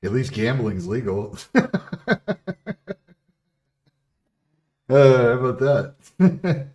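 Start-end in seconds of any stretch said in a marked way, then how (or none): 1.4-2.9 loud real laugh; loud high laugh. Real, not acted. Laughing at his own joke. 4.9-6.7 loud high gloat; loud high teasing gloat. Real, not acted. Gloating over the steroid jab.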